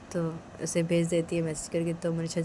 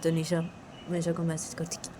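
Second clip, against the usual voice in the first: plain reading voice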